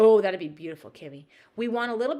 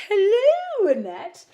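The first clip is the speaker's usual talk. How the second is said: sing-song hello; 13 semitones higher, 14 dB louder, much slower than usual. Real, not acted. Greeting a viewer in chat.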